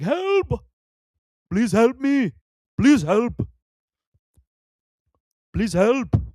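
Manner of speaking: high, loud panicked screams for help; desperate cry for help. Retold or imitated. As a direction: yell for rescue like he's being chased. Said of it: "he's just like".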